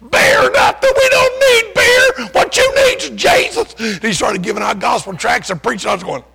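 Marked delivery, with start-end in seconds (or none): 0.1-3.7 high, loud, cracking shout; full-throated preacher's cry against the beer. Retold or imitated. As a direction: belt it out like a fired-up street preacher. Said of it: "this one guy there jumped up and said".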